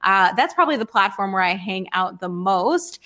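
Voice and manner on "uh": usual speaking voice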